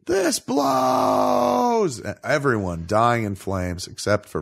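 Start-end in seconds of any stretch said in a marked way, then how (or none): 0.1-2.0 high mock whine; hammed-up high complaint; mock henchman. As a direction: gripe as a doomed henchman. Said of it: "people are like".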